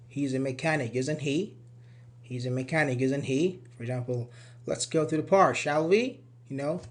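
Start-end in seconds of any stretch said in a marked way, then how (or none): 0.1-3.6 "isn't he" rising at the end each time; rising tag intonation. Shown as a demonstration. 4.7-6.2 "shall we" with a rising end; rising tag intonation. Shown as a demonstration.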